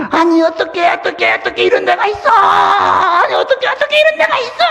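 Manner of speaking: high shouting, wailing disbelief; a shrill wailing protest in terror; anguished disbelief. Retold or imitated. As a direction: cry out in horror at the place. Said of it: "이렇게 말하는데".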